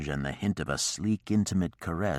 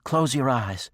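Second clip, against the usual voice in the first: high, loud and pleading; urgent plea; 9 semitones higher, 5 dB louder than usual. A narrator's reading. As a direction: beg him to look away. Woman pleading, embarrassed.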